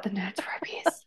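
longing, breathy whisper. Real, not acted. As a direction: whisper it like a guilty craving. Craving candy, hushed reaction.

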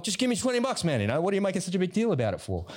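put-on high pleading teen, far above his own pitch; whiny teenage son. Teen son begging dad for movie money, high whiny plea. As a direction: squeal it like an exasperated teen.

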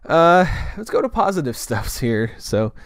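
ordinary delivery

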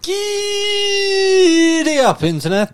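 high, drawn-out yell; cowboy shout. Pretend. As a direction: holler like a cowboy.

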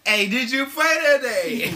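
high, slow, loud mock menace; scary man imitation. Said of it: "This is a lot of scary shit".

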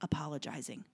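near-whisper, firm and kind; hushed reassurance. Retold or imitated. Woman hushes the apologizing mother.

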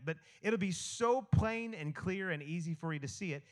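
usual speaking voice

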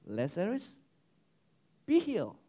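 softly spoken healing word, with a pause between; understated command. Acted. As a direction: say it gently, as a simple suggestion. First, understated version of Jesus' command.

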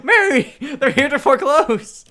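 very high, loud delighted call; giddy shout. Acted. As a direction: call out to Mary, thrilled.

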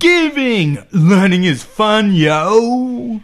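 put-on loud squealed kids' show chant; hammy shout. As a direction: bellow it like a kids' show host.

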